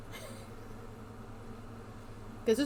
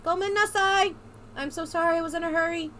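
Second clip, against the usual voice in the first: lightly voiced girl, higher pitch; apologetic girl; 5 semitones higher, 4 dB quieter than usual. Girl apologizing to the man she bumped into, lightly voiced, apologetic.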